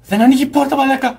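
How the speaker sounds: high, alarmed complaint; panicked cry. Real, not acted. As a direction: cry out in real alarm at being trapped. Trapped, door will not open.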